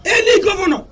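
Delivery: high-pitched, heated tirade, shouting on key words; impassioned shouting rant. Real, not acted. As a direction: rail against the governors with rising fury. Passionate rant against governors.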